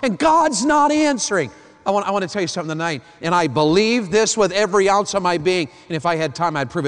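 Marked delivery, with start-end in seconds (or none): 0.0-1.5 mock complaint. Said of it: "people say this to us".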